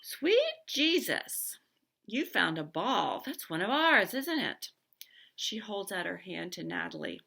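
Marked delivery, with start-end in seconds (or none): 0.0-4.7 slow, high, loud astonished exclaim; excited amazement. A narrator's reading. Piper spotting the baseball, excited astonishment. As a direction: marvel at the find.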